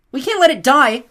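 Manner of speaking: high, loud plea; urgent high-pitched appeal; Selena pleading. A narrator's reading. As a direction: beg them to save the dragon. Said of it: "Selena said".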